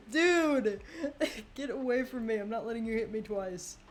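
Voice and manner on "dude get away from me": louder, laughing plea to back off. Real, not acted. Chased in game, laughing.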